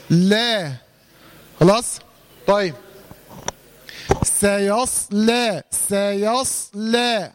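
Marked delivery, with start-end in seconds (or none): 4.2-7.3 سيصلى drawn out, emphatic ص and light س; slow recitation demo. Shown as a demonstration. Teacher models سيصلى slowly for the student. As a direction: stretch سيصلى slowly, jaw loose. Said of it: "عايز الفك كده يكون مرن".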